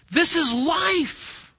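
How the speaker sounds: high, drawn-out exclamation; mock exclamation. Preacher sums up what you see everywhere, mock exclaiming. Voices an exasperated general conclusion.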